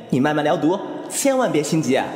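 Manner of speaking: normal talking voice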